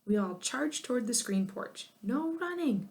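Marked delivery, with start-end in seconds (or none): 2.0-2.9 high called-out warning; hollered warning. A narrator's reading. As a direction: call it across the stampede. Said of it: "Missus Wonk hollered".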